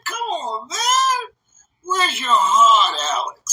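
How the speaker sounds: hammed-up hurt; mock wounded plea. Patient protesting to the assistant, mock wounded plea. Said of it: "I said".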